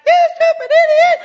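put-on high, loud little-kid echo; child copying. Kid in the back seat echoing dad, high and loud. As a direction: parrot it like a small child. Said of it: "you hear in the back".

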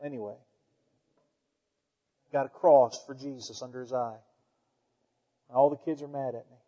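ordinary talk

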